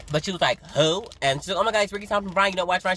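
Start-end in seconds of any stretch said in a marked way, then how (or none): 0.7-1.0 loud, higher, dismissive question; mock rude attitude. Rude coworker hears the name from the fan, with attitude. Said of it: "Like, with an attitude". 1.6-2.9 high, excited insisting; fan freaking out. Retold or imitated. Fan explains to her unimpressed coworker, freaking out. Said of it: "So she was freaking out".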